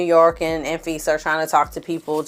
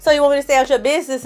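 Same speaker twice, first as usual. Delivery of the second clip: fast, higher challenging question; mock confrontational Omarosa; confronting quote; 7 semitones higher, much faster than usual. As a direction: rattle it off as a challenge.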